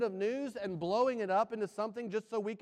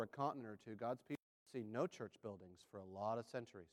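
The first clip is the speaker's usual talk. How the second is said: very low, soft voice with long pauses; hushed, reflective preaching; 10 semitones lower, 9 dB quieter than usual. Real, not acted. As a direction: bring it right down, quiet and unhurried.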